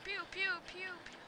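soft, high pew-pew noises; play-shoot with little soft pews; imitated gunshots. Imitates shooting noises while playing.

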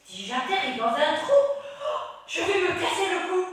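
high, loud laughing witch. Acted. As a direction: shriek it like a giggling witch. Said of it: "une voix de sorcière".

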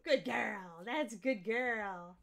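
slow drawn-out praise for the dog; encouraging dog praise. Real, not acted. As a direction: coax the dog, stretching it out. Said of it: "I have to encourage her and get her all excited".

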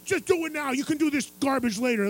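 high, loud dismissive order; brash drunk demand. Retold or imitated. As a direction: wave the host off. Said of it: "he's like".

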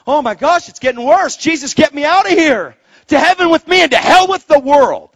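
loud, high imitated alarm, building; mock panic. Believer begging Jesus for escape from the world, panicked. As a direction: cry out in hammed-up end-times dread. Acts out end-times panic.

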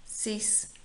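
usual speaking voice

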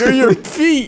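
normal talking voice

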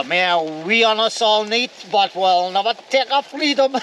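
rally the troops, tongue in cheek; mock rousing speech. Campers in the snow, mock heroic freedom speech.

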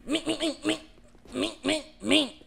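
high, loud repeated mink noise; imitating monster sound. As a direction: mimic the enemy's attack sound.

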